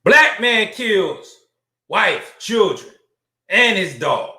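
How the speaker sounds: slow, punched-up newsreader voice; emphatic news headline. Acted. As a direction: hammer out each item of the headline slowly.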